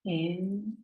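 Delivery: soft, slow, drawn-out breath counts; guided breathing meditation. Acted. Yoga teacher counting listeners' breaths in and out, hushed meditative guiding. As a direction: pace the breath gently, holding each count long and level.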